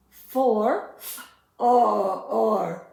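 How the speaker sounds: "f, or" split, loud; sounding out. Shown as a demonstration. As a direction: push out "or" after "f". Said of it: "learn these two letters together".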